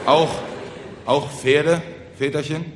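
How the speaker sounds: hesitant, worried question on AUCH PFERDE; mildly fearful, halting villager; anxious question. Acted. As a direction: ask falteringly, afraid of the answer. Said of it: "fragten ängstlich die Bauern".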